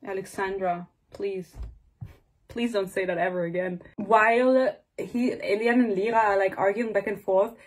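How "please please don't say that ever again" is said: usual speaking voice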